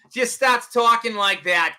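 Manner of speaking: mock Boston accent. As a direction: put on a bit of Boston accent. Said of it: "Nobody adopts that accent".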